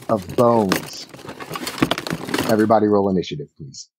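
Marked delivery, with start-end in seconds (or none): none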